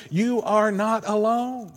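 slow, high, sustained declaration; very clear message. Retold or imitated. Preacher passing Jesus's message to a lonely congregation, slow and clear. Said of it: "his message to us very clearly".

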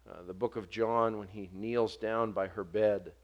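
usual speaking voice

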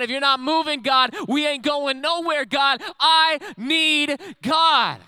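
impassioned chanting sermon climax; full-force preaching. Real, not acted.

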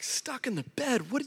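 baffled dad, almost laughing; quiet incredulous question; bewildered disbelief. Retold or imitated. Dad's bewildered reply.

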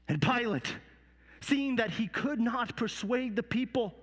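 normal talking voice

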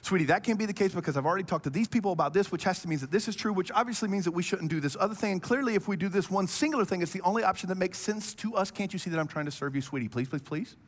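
breathless rapid-fire pleading; excited fast talking. Retold or imitated. Husband steamrolling his wife in a small disagreement, very fast and insistent. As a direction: race through the argument without letting anyone in. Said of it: "I kinda speed up a little bit".

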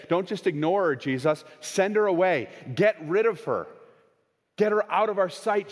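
pressing Jesus to send her away; urgent disciples. Acted.

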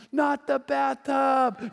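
put-on drawn-out, high wailing child; hammed-up tearful protest; mock crying. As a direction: wail it slowly like a crying boy. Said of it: "And he's crying".

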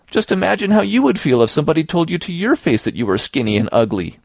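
put-on high, plaintive girl's plea; tearful pleading. A narrator's reading. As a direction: plead as if near tears, pitched high. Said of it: "pleaded Anne tearfully".

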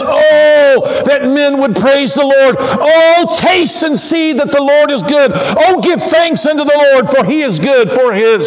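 high, fervent proclamation of praise; exultant cry from the depth of the soul; soul-deep Oh. Shown as a demonstration. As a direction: launch each "Oh" high and full. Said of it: "this deep breath from the depth of the soul".

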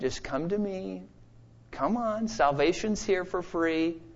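soft, pleading invitation; God's tender offer. Pretend. God softly invites rebels to come to him. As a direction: coax rebels gently back home. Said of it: "And now he's saying".

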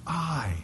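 "I" set apart, high but soft; emphatic I. Shown as a demonstration. Preacher shows the doubled Greek pronoun, soft emphatic "I". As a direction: isolate "I" on a high, hushed note. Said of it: "it's emphatic there in the Greek".

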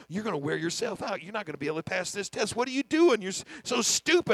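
anxious inner critic snapping at himself; self-scolding. Retold or imitated. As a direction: berate yourself in a panic, building to a shout. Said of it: "I started telling myself".

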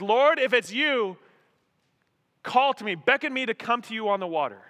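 loud, earnest request; calling out. Retold or imitated. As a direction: call across the water to the Lord. Peter calling across the stormy water.

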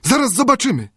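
high, eager servant voice; high excited servant; Friday's voice. A narrator's reading. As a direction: say it high and keen.

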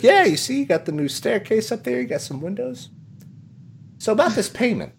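high salesman showing off, easing down; Tom Nook. Acted. Salesman showing renovations before billing, high patter. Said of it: "and he's like".

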